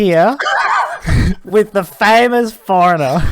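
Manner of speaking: show host announcer. Acted. Co-host introducing his guest on air, grand announcer voice. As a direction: present the guest grandly, laughing midway.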